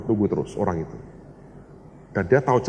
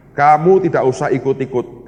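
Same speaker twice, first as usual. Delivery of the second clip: louder, emphatic prohibition; firm retold command; 3 semitones higher, 8 dB louder than usual. God telling the preacher to stay out, firm and loud. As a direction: lay down the order with force.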